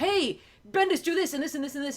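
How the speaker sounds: high, loud hail into a list; pestering demands. Retold or imitated. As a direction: hail him loudly, then pile on orders. Publisher piling demands on writer.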